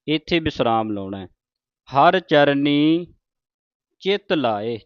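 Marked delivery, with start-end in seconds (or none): 1.9-4.8 ਚਰਨੀ said with a retroflex ਣ, tongue curled back; slow, ਚਰਨੀ with the ਣ sound; ਚਰਨੀ ਵਿੱਚ ਣ ਦੀ ਆਵਾਜ਼; clear retroflex n. Shown as a demonstration. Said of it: "ਚਰਨੀ ਨਾਣੇ ਦੇ ਨਾਲ ਹੈ".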